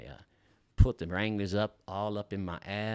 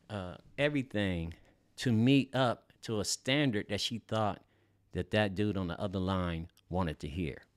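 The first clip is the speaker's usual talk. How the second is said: ordinary talk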